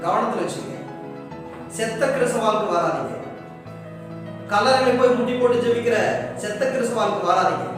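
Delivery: usual speaking voice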